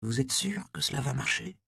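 hushed, tentative question; murmured. A narrator's reading. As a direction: murmur a worried question into his ear. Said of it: "murmura à l'oreille de son maître".

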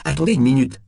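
high, loud plea without a stutter; alarmed repeat. A narrator's reading. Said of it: "répéta Temple".

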